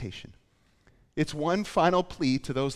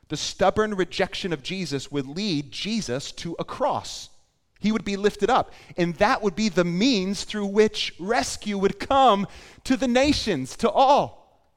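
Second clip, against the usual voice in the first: building to a loud, high preaching climax; impassioned preaching; 7 semitones higher, 7 dB louder than usual. Real, not acted. Preacher proclaiming rescue to the nations, impassioned and building. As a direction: preach it out with rising force.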